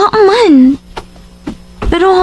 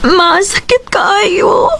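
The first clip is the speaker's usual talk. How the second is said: slow, tearful crying plea; 5 semitones higher, much slower than usual. Acted. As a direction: break down in tears to mom. Crying to mother.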